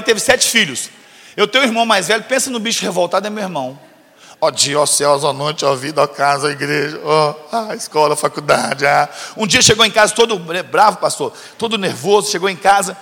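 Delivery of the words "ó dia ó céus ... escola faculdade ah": dramatic lament. Retold or imitated. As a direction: lower and groan it like a sulking teen. Imita irmão revoltado lamentando. (lamento teatral e grave de adolescente revoltado)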